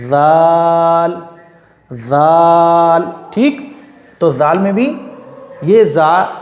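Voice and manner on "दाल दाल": दाल stretched very long, tongue on the front teeth; drawn out, tongue on teeth. Shown as a demonstration. Teacher demonstrates दाल to students, long and slow. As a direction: hold दाल out slowly. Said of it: "आगे के दांतों से लगेगी".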